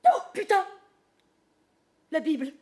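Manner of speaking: high, loud dismayed curse; startled cry. Acted. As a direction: blurt it out in shock. Sudden dismay at the book.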